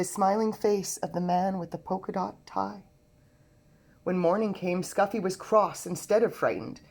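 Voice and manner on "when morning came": plain reading voice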